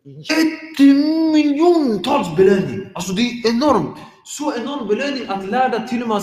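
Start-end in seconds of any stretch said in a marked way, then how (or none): 0.0-2.9 drawn-out, high and loud JÄTTEMILJONTALS; big emphatic stress on JÄTTEMILJONTALS; hugely emphatic. Real, not acted. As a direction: stretch JÄTTEMILJONTALS out slowly, pitched way up. Emphasising a huge reward.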